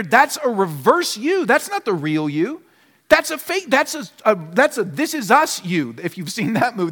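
usual speaking voice

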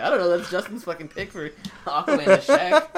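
loud, high-pitched, laughing through the words; cracking up. Retold or imitated. Explaining a co-host's absurd casting pick, cracking up. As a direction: barely hold it together as you quote it. Retelling the joke while cracking up.

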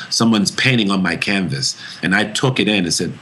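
ordinary delivery

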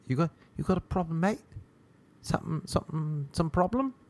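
put-on English accent drifting into Australian; botched English accent. Gym guy asking about a problem, accent slipping Australian. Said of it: "That sounded a bit Australian".

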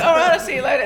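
high, loud laughing brush-off; mock brush-off. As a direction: squeal the goodbye through laughter. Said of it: "And I'm like".

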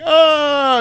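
very high held wail; hammed-up dying vampire scream; mock melting scream. Melting vampire jumps out of the tub, mock scream. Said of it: "And he's all melting".

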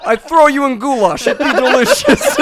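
put-on Russian accent. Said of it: "Your shitty Russian accent".

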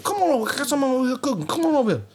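imitating a Cajun cook waving them in; Cajun backwater accent. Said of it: "that's exactly what he sounds like".

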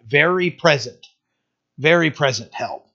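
"very" hit firmly, a slight lift on "very" each time; stress on very. Shown as a demonstration. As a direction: lean into the word "very". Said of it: "you see the emphasis that's placed there?".

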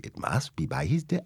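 put-on testing an East African accent, softly; softly trying out a Welsh-sounding African accent; muttered practice run of an African accent; accent rehearsed in doubt. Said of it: "in the middle of the night going".

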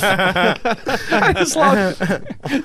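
slow words through a laugh; savoring the story, laughing. Real, not acted. Laughing delight.

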